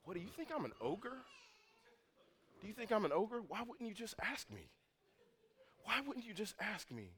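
imitating dad. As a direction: gently voice a dad asking why they didn't just ask. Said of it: "My daddy looked at us and he said".

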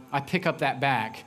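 usual speaking voice

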